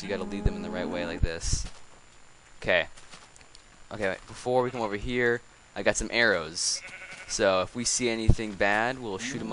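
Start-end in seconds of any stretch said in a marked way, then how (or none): none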